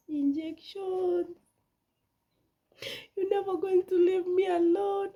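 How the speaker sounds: high, slow hammed-up crying wail; hammed-up sobbing lament, pitch way up; mock wail. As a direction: wail it like a dramatic victim. Mock wail about injections.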